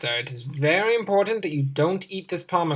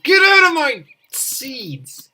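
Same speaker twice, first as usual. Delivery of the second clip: loud, slow shout at thieving birds; yelling at birds; 9 semitones higher, 7 dB louder, slower than usual. Real, not acted. Gamer yelling at birds in his seeds, loud angry shout. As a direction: bellow them off the seeds.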